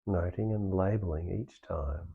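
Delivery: calm meditation guide with long pauses; guided meditation. Real, not acted. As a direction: ease them through it, leaving space between phrases. Leads the meditation practice.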